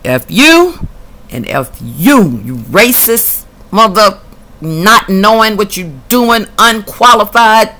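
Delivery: angry retold tirade. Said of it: "I told 'em both what I wanted to say".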